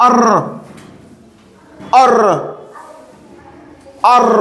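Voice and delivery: আর as a neutral 'er', not the letter name; isolated 'er' sound. Shown as a demonstration. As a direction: model আর as 'err', long pauses between repeats. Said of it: "এরকম উচ্চারণ হবে".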